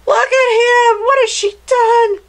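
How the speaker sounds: very high, loud horrified exclamation; shocked. Acted. Reader to listener about the witch's spell, shocked cry.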